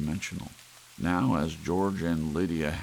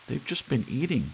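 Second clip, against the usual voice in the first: wife's voice; 9 semitones higher than usual. Acted. Wife pointing out lions to husband, high voice. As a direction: point it out to him, high and keen.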